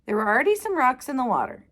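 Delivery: ordinary delivery, nothing marked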